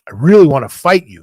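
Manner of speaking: loud burst of pent-up anger; retold anger. Angry young wrestler to the vet who slapped him, retold angrily. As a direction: retell it as a loud, frustrated challenge. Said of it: "he can see that I'm getting angry".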